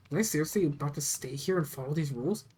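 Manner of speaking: ordinary delivery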